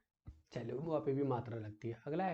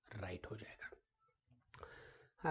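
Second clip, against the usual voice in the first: whisper; 3 semitones lower, 10 dB quieter, much slower than usual. Real, not acted. Teacher confirms answer.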